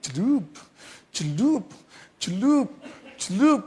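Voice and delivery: short swooping jabs, one by one; sharp plunging sound effect, pitched high; imitated piercing jabs. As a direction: poke out each sound with a swoop. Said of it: "kalimat-kalimatnya menyelekit".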